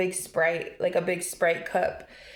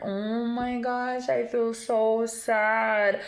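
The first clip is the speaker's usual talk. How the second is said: sad; 3 semitones higher, much slower than usual. Real, not acted. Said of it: "this is the sad part".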